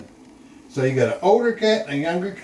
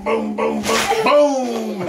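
playful hippo stomps; 3 semitones higher, much slower than usual. Pretend. Grandpa reading animal book to toddler, playful booming hippo. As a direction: make big hippo booms for a small child.

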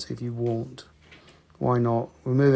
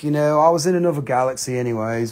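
ordinary delivery

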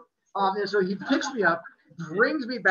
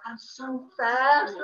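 slow, drawn-out, proud and higher; gleeful; 6 semitones higher, 5 dB louder, much slower than usual. Retold or imitated. Kid sprinting past mailboxes, thrilled with himself. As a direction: savour every word of it.

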